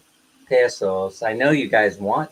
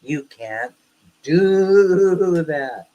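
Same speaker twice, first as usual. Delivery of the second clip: mock lecture; 4 semitones higher, much slower than usual. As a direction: lightly mimic a wife's lecture, stretching "do". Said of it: "I got lectured after that".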